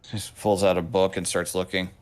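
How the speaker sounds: ordinary talk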